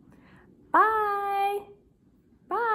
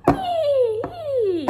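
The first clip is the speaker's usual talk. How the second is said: excited whee; 9 semitones higher, 8 dB louder than usual. Acted. Horse riding play.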